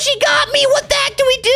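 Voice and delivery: high-pitched frantic cry; panicked. Acted. Kid grabbed by the robot, panicked. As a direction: wail it high, caught.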